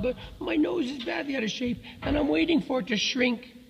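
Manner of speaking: put-on high-pitched elephant child voice; young elephant. A narrator's reading. Elephant's child explaining his nose to the python, high and childlike.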